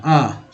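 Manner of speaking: अ as a short neutral vowel. Shown as a demonstration. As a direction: model the isolated sound अ, as in flood. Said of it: "अ के रूप में हुआ". (अ की छोटी ध्वनि)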